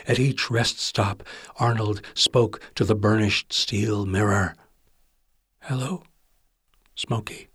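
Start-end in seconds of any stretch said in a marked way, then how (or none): 5.6-7.5 softly, privately, to his reflection; hushed hello to his own new name; quiet self-greeting. Acted. Greets himself in mirror.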